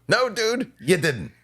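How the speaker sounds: squeaky, incredulous no dude; high-pitched scoff; mock high reply. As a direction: hit it high and disbelieving. Mocking reply to quote.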